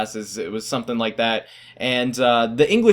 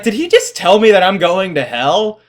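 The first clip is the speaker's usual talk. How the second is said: high, loud incredulous question, acted out; shocked disbelief; 7 semitones higher, 7 dB louder than usual. Student reacting to teacher's threat, loudly incredulous. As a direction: exclaim it in mock shock, pitched up.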